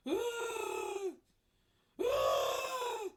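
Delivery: high, loud imitated wail; mock wailing. Husband wailing from the kitchen over food, mock wail. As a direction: wail like a grown man in despair. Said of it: "we hear this wailing".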